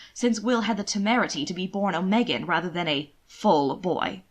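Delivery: ordinary delivery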